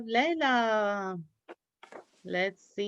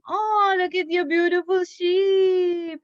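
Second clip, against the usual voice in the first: excited praise; 9 semitones higher, 6 dB louder than usual. Real, not acted. Teacher praising Kazem's sheep craft, excited high gush. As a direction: burst out with delight as if seeing a treasure.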